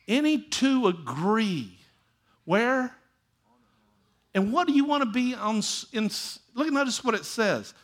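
preaching with a touch of tears; impassioned preaching. Acted. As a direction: press the point with feeling, almost crying. Preacher builds to emotional climax.

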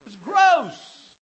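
high, loud burst of comic revulsion; disgusted shout. Acted. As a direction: blurt it out, hammed-up and disgusted. Exclaims disgust for comic emphasis.

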